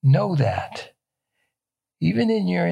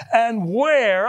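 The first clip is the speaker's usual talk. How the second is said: where pushed up and held; stress on where; 5 semitones higher than usual. Shown as a demonstration. Preacher quoting Gideon, stressing where. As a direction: lean into the word where. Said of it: "underline the word where".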